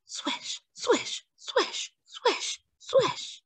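breathy whispered swishes of swimming strokes; whispered swish sounds. A narrator's reading. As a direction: whisper each swish like an arm slicing the water.

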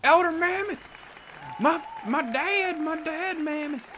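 high, loud, drawn-out mock cry; excited calling out. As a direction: call out to your long-lost mammoth dad, high and loud. Calls out to elder mammoth.

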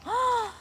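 a quick high gasp of delight. Real, not acted. Vlogger unwrapping a crystal from Casey, delighted gasp.

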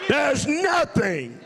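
high, ringing triumphant proclamation; full-force preaching. Real, not acted. Preacher proclaiming God's victory over death, triumphant full-force preaching. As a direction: preach it high, stretching the key words.

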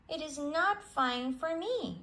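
sulky youngster refusing his home; young mole, defiant. A narrator's reading. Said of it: "said Mole to himself".

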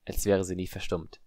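plain reading voice

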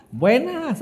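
high, drawn-out mock hello; acted greeting. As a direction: sing out a nosy greeting. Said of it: "así como".

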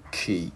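"key" with a puff of air on the K; aspirated K. Shown as a demonstration. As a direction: pop the breath out at the start of "key". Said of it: "Cái mình bật hơi chữ K ra".

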